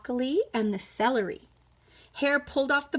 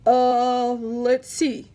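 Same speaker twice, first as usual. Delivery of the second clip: yawning bear; 6 dB louder, much slower than usual. Acted. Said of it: "Bear said with a yawn".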